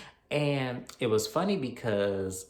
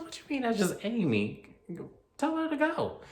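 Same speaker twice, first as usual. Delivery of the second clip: high-pitched, put-out retort; imitated indignant demand, pitched way up; mock indignation. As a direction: act out an affronted girlfriend. Acted quarrel over intruding assistant.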